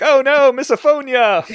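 high, loud mock dismay; mock horror. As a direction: shriek it in fake horror. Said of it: "they're gonna be like".

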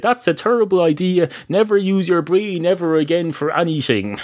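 lightly acted, discouraging put-down; mock scolding. Someone discouraging Einstein after a stupid idea, mock scolding.

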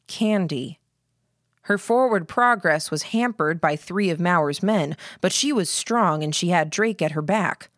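plain reading voice

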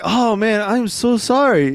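high, sheepish apology; apologetic. Retold or imitated. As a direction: sound guilty and sorry. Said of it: "like he felt".

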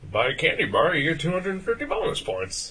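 high-pitched mock sales pitch; upselling clerk. Clerk upselling candy at the gas station, imitated high sales pitch. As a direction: imitate a clerk pushing the deal, voice lifted high. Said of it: "they do the whole upsell".

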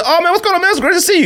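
high, gushing mock greeting; mocking his own corny greeting to a friend; overly friendly greeting. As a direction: ham up a corny warm hello. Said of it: "When I saw him, I said".

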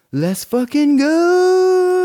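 high, loud, drawn-out cheer; slow, stretched hype shout; excited whooping yell. Real, not acted. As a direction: holler it long and high. Cheering about going to festival.

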